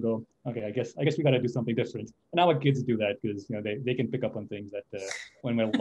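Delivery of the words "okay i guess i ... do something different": quick, run-together words tossed off; rushed retold remark. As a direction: rattle it off fast, half to yourself. Couple stopping their fight.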